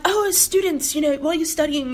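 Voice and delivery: high, fast, bubbly mock admirer; gushing European fan. Imitating Europeans impressed by theater students, gushing high. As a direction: gush up high like a thrilled stranger. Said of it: "people were like".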